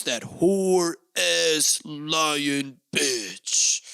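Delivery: very slow, every word dragged out; drawn-out insult. Pretend. Podcaster ends his news spoof, insult stretched very slow. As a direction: savour each word of the insult.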